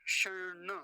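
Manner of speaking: शर्ण as shaṇ-ṇa, held retroflex n; retroflex model. Shown as a demonstration. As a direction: curl and hold the double n in शर्ण. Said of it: "देखिए क्या बनेगा".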